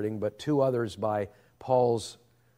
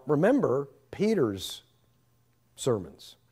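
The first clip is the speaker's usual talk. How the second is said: usual speaking voice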